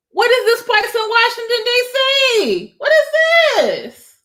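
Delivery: real excited outburst, voice jumping high; high, incredulous exclamation; high-pitched excitement. Real, not acted. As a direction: ask it in high-pitched disbelief. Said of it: "I get higher when I get excited".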